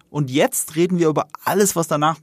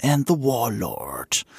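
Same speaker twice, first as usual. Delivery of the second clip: dramatic title read; 5 semitones lower, much slower than usual. Acted. Reads episode title dramatically.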